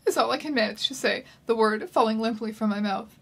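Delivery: ordinary talk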